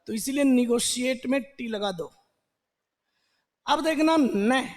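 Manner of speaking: ordinary talk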